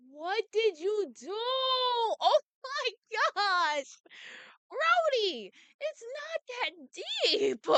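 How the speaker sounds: high-pitched, drawn-out disbelief; aghast. Acted. Reader reacting to a killer's confession, high and aghast. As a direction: exclaim high, as if appalled by the news.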